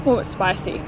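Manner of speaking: usual speaking voice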